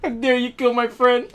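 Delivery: high, loud, whiny fake scolding; mock whiny outrage. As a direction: pout it out like a petty brat. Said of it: "imagine someone kills your friend".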